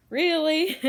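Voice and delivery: high loud exasperated really. Retold or imitated. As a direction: scold the pet in disbelief. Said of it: "I'm like".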